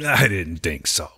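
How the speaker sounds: low, slow mob boss; mobster voice. Pretend. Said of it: "every one of my characters sounds like that".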